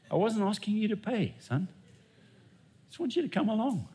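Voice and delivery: gentle fatherly God voice. Acted. God gently inviting his son to lunch, softly. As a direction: coax the son along kindly.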